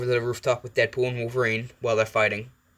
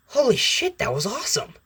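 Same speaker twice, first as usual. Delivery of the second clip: excited exclamation; 12 semitones higher than usual. Acted. Acted Deadpool amazed by a stunt.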